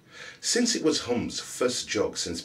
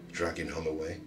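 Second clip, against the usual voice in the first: ordinary delivery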